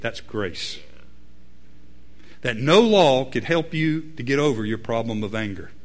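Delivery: normal talking voice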